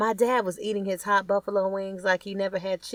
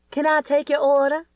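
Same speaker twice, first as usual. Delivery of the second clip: higher, louder chirpy server voice; higher, louder waitress taking an order; 10 semitones higher, 7 dB louder than usual. Acted. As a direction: greet the table like a bright waitress. Waitress greets the table.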